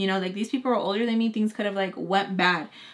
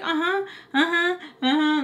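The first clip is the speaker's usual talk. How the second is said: imitating overheard chatter; 8 semitones higher than usual. Imitating overheard phone talk.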